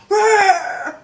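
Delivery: high, loud, drawn-out growling yell; mock angry scream. Man in car going off at her, mock scream. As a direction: imitate his wordless roar. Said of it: "He went like".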